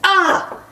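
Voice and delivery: sudden high joking yelp; fake yelp. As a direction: let out a mock yelp. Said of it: "Just kidding".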